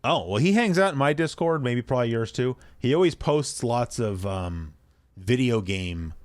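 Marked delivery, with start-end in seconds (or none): none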